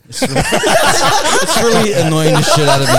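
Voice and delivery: high laughing complaint. Real, not acted. Laughs at annoying microphone.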